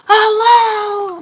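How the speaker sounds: very high, drawn-out yelled hello. Acted. Mom getting no response on phone, yelled hello. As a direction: yell it long and high down the line. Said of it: "I'm not responding".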